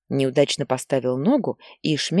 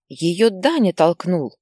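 slow, louder cry, Даня pressed hard; girl's accusing exclamation; 3 semitones higher, 4 dB louder, slower than usual. Acted. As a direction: blurt out who did it, hitting Даня. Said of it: "вдруг сказала другая девочка". (девочка громко и медленно выкрикивает «Даня»)

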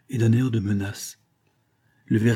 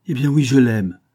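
loud, higher-pitched defiant admission; proud retort; 4 semitones higher, 8 dB louder than usual. A narrator's reading. Wife admitting her love to her husband, proud. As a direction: own it proudly, full voice. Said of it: "répliqua-t-elle fièrement".

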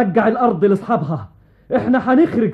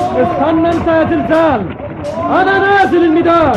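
commanding call; 6 semitones higher, 3 dB louder than usual. Acted. King heads to the battlefield.

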